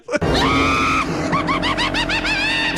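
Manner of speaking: very high, shrieking laugh; helpless laughter. Real, not acted. Host finds the Crypt Keeper hilarious, helpless laughter. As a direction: crack up in high-pitched peals. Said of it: "It always makes me laugh".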